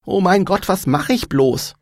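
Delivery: high-pitched, fretting outburst; flustered excitement. A narrator's reading. Anja wonders what to do about the star, flustered. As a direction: fret aloud in starstruck panic.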